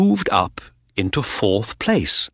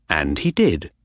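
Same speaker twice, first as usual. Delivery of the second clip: ordinary delivery, nothing marked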